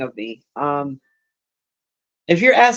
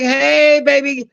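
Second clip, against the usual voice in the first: high, flirty imitated greeting; 6 semitones higher, much slower than usual. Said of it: "He was like".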